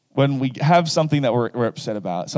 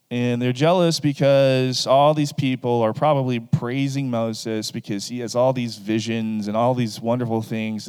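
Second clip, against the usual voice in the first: ordinary talk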